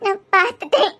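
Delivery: tearful, halting confession; weepy, choked-up admission; crying. Acted. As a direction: get the words out between sobs. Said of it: "பின்ன அழுதுகிட்டே இரு".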